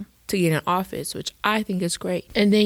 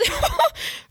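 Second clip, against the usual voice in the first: high burst of laughter. Real, not acted. Laughing at the headline.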